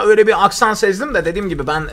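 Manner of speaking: normal talking voice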